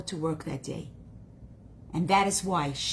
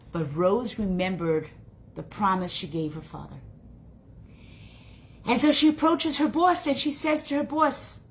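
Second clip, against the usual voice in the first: ordinary delivery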